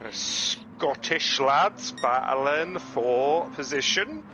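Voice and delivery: slow, hammed-up Scottish accent; drawn-out put-on Scottish lilt. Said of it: "That is my Scottish accent".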